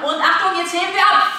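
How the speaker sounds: high, loud, rising excitement; excited take-off call. Pretend. Coach sending pretend helicopters into the air, excited. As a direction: launch them like a helicopter.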